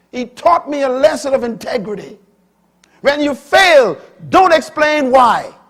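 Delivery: high, loud pulpit delivery; full-force sermon voice; fiery preaching. Acted. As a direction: preach it out to the congregation.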